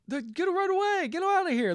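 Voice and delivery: mock panicked shout. Heroine shouts at the cops.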